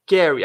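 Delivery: "Carry" said loud and short, stress on car; model word. Shown as a demonstration. Teacher modeling "Carry" before students repeat. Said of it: "Agora repita".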